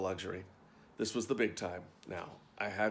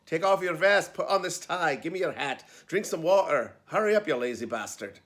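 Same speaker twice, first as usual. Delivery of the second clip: loud, higher bossy Scottish-dresser orders; barked orders; 6 semitones higher, 7 dB louder than usual. A narrator's reading. Dresser pushing actor through costume changes, barking orders. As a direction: bark them out, hustling the actor along.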